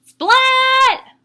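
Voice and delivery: SPLAT! yelled way up high and loud; big shout. Acted. Splat leaping into the leaves, a big gleeful shout. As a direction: shout it as he leaps in the pile. Cat jumps into leaf pile.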